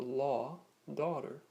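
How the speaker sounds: North American "law, daughter" with open aw and sounded final r; North American accent. Shown as a demonstration. Teacher giving North American example words, American open aw in "law, daughter". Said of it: "North American style usage".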